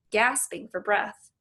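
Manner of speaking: plain reading voice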